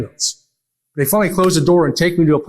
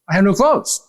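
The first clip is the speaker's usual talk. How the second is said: pitched up, rising at the end; insistent pleading; 5 semitones higher than usual. Retold or imitated. Repeating it to the doctor, pitched-up plea. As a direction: plead again, higher and more urgent. Telling the doctor all night.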